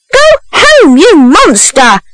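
high-pitched, indignant order; outraged scolding. A narrator's reading. Second woman onlooker to a runaway boy, high outraged scolding. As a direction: send them off in high-voiced outrage.

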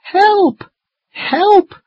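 high-pitched frightened calling out; scared cry for help. Acted. Children stuck high in a tree, scared calls for help. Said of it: "Now we feel scared".

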